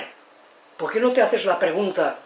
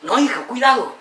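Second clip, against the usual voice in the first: higher, louder cry of warning; alarmed mother; 6 semitones higher, 5 dB louder than usual. Retold or imitated. Mother warns crawling daughter at stairs, alarmed. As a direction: imitate a worried mum warning her child. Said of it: "Y la madre".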